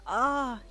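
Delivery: loud, high sharp "Ah"; gasp. Acted. Deku realising he forgot to text, a loud gasp. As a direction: catch your breath in sudden realisation. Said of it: "he gasped".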